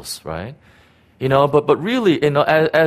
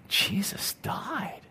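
slow breathless whisper of wonder; hushed awe; awed whisper; 9 semitones higher, 11 dB quieter, much slower than usual. Retold or imitated. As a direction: whisper it as if struck. Awed private realization.

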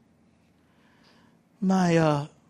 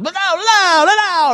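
loud, high garbled mock Brazilian; bad Brazilian accent; 5 dB louder, slower than usual. Master says something unintelligible, mock Brazilian accent yell. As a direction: shout gibberish as a badly faked Brazilian. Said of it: "I can't do a Brazilian accent".

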